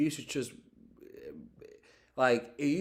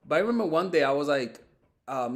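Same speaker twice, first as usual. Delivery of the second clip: normal talking voice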